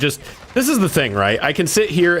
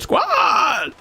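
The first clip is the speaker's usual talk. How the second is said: drawn-out cry cracking on SQUAD; strange voice crack. Retold or imitated. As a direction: imitate a held-back shout that breaks. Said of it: "it's such a strange, like, voice crack".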